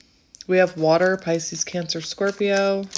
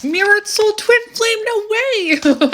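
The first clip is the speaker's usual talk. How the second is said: much higher, louder squeal of delight; thrilled; 7 dB louder than usual. Real, not acted. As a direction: shriek with amazed joy. Surprise card reveal.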